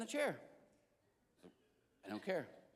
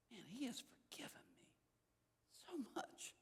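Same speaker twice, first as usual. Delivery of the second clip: soft breathy whisper of wonder; whispered awe; 4 semitones higher, 14 dB quieter than usual. Retold or imitated. Whispering to himself over the book, moved. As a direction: hush it out, moved. Inner thought while reading, whispered.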